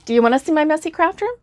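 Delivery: high, fast, eager question; excited invite. Real, not acted. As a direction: ask it like a bubbly tease, rising high. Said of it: "I thought maybe you might wanna see".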